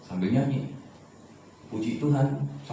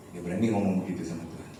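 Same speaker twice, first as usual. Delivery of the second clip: ordinary delivery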